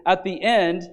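usual speaking voice

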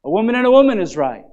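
mocking quote. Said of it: "we say".

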